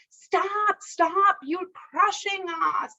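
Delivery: high cry of stop, stop; ant king's cry. A narrator's reading. Ant king to young man, a high alarmed stop. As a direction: cry out to halt the rider.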